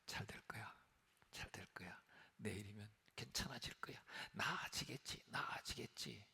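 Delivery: hushed whisper, hoping it gets better; a worrier whispering comfort to themself; whispered self-reassurance. Retold or imitated. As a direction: whisper it to yourself to calm down. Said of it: "그런 줄 알았어요".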